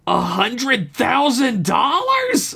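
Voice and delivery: high, slow squealing screech; schoolgirl screech. A narrator's reading. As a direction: shriek it like an excited schoolgirl. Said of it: "screeched out like a schoolgirl".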